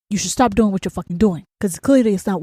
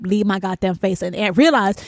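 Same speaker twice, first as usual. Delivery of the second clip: ordinary talk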